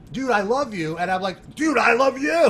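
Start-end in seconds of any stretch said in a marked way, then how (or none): 0.1-1.0 a touch of gushing. Retold or imitated. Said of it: "I think he said something like". 1.6-2.5 excited shout back. Retold or imitated. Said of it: "I gave it back to him".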